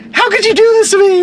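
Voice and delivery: high, loud whiny wail; whiny accusation. Retold or imitated. Daughter accusing her dad, in a high whiny wail. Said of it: "and then".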